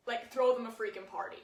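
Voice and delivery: ordinary delivery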